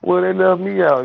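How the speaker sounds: high-pitched mock complaining; imitated complaint. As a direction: act out someone griping about being excluded. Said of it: "you know how people be like".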